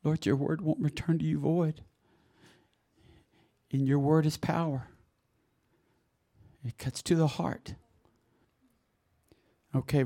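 usual speaking voice

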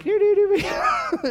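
put-on high squeaky childish babble; mock defiant child. Pastor mimicking a child answering back to parents, squeaky mock kid. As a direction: play a cheeky kid talking back. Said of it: "a child coming to mom and dad and being like".

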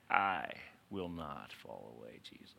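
sudden drop to a very low, hushed solemn pledge; emphatic low vow. Acted. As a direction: sink deep and soft, word by word. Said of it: "Peter was speaking emphatically".

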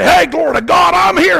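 high, loud battle shout; hollered challenge. Retold or imitated. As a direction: holler up the hill at the enemy. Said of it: "I'm just gonna holler at 'em".

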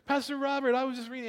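a new believer rushing up to the pastor; excited voice of a new convert; eager new believer. Retold or imitated.

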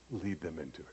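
gentle hush. Shown as a demonstration. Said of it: "Don't pound them into it".

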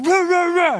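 high loud imitated barking; dog barking. Pastor imitating the dog charging him. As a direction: bark like a charging German Shepherd. Dog retreats a third time.

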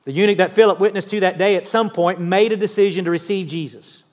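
normal talking voice

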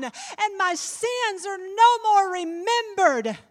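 building to a high, loud, sustained cry; impassioned scripture recitation. Acted. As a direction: swell from firm voice to full-force preaching.

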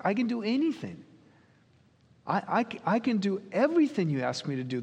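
high, fast, insistent assurance; emphatic assurance. Acted. Jesus insists on his power, acted.

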